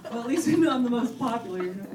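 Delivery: normal talking voice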